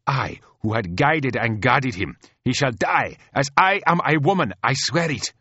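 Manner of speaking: high, loud impassioned oath; furious vengeful queen. Acted. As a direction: build to a ringing, defiant vow.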